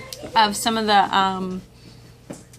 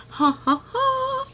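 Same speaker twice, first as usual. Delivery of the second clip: mock laugh; 4 dB louder, slower than usual. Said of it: "the best thing I found that was just like".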